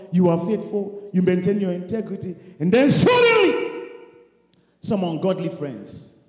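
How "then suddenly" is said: high shouted burst; sudden shout. Real, not acted. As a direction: blast the word suddenly.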